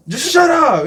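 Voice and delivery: high, loud heckler imitation; mock angry shout. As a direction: yell it like the fed-up man. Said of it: "he's just shouting".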